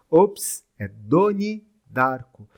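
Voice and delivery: corrected "Donnie Darko", stressed slowly; slushy sh for s. Shown as a demonstration. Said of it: "Eu tenho a língua presa".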